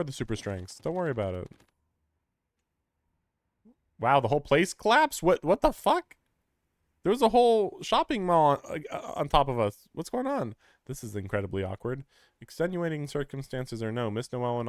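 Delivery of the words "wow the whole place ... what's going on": shocked shout. Acted. Reading shocked character lines aloud.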